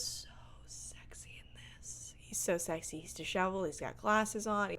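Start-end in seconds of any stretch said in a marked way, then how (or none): 0.0-2.4 swooning whisper. Pretend. Host swoons over the nerdy actor, whispering. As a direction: whisper it as if smitten.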